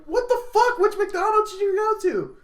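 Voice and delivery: high, loud disbelieving squeal; shocked outburst. Retold or imitated. Telling his roommate, shrieking in disbelief. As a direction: squeal in total disbelief at the price.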